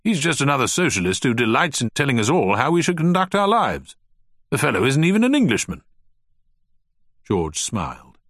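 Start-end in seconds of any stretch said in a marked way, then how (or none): 0.0-5.8 higher, faster blustering rant; indignant old gentleman. Acted. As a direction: bluster like a stuffy Victorian host. Turner rants about Shaw.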